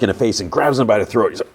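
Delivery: plain reading voice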